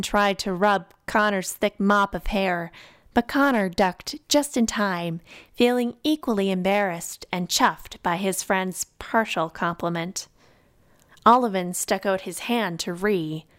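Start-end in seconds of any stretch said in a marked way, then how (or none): none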